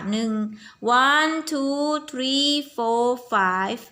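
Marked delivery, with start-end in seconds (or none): 1.1-3.8 slow, higher sing-song counting, "one two three four five"; counting aloud for children. Shown as a demonstration. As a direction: count the worms slowly with the class. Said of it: "มีหนอนกี่ตัว". (นับช้าๆ เสียงสูงแบบชวนเด็กนับ)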